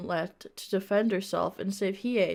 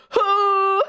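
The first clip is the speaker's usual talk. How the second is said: loud, high held mock gasp; hammed-up drawn-out "huh"; gasp for breath; 11 semitones higher, 7 dB louder than usual. A narrator's reading. Link falls out and gasps for breath, hammed-up gasp. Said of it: "gasped for breath".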